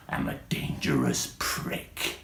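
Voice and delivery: breathy menacing whisper. Acted. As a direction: whisper it like a threat. Mock menace after poem.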